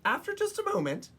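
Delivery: ordinary delivery, nothing marked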